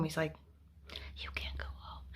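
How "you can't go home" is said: whispered secret; breathless hushed warning. Retold or imitated. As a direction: whisper it so no one overhears. Secret told in the shower.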